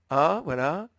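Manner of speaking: a little laugh of relief at finally finding it; relieved laugh. Real, not acted. Instructor laughs on finding the letter A, laughing relief. Finds letter after struggling.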